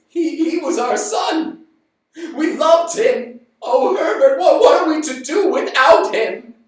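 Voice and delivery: father weeping to the stranger; grieving father. Acted. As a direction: sob out the loss of a son. Father grieving his son.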